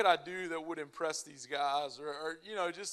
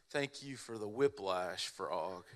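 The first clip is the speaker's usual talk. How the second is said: sarcastic; 8 semitones lower, slower than usual. Retold or imitated. Sarcastic thanks after the jerk.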